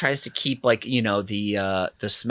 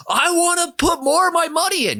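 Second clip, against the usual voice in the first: high, squeaky mock voice of a stubborn man; a high, silly caricature of the tipper; mocking whiny tipper; 13 semitones higher than usual. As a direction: parody him insisting in a silly high voice.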